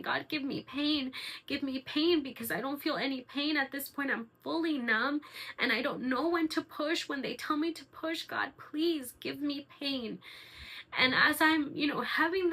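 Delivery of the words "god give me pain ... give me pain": high, pleading prayer; emotional praying. Retold or imitated. Woman in labour praying for pain, pleading. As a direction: beg God in a raised, urgent pitch. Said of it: "And I started praying".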